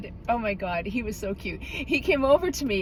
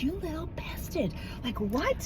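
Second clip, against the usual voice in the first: mock mad mutter; 4 semitones higher, 10 dB quieter, slower than usual. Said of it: "I was mad".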